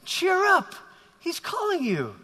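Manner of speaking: lightly voiced gruff man turning encouraging; a hint of gruff heckler, high in pitch. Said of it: "this same gruff voice says".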